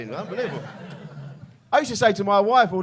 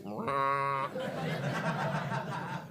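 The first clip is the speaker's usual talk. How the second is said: imitated cattle-like drunken noise, softer and held; wordless drunken drone; 2 semitones lower, 10 dB quieter than usual. Drunk lads answering "All right, boys", mock wordless drone. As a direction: drone back with no words, as if plastered. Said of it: "No words anymore, just that".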